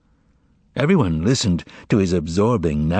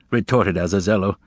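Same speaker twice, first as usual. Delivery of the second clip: plain reading voice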